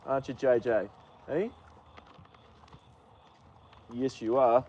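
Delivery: cooing to horse. Real, not acted.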